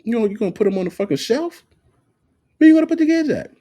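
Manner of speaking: high, fast, rising to a loud yell; high exasperated rant at the ex-wife. Retold or imitated. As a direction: pile on the incredulous questions. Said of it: "I said".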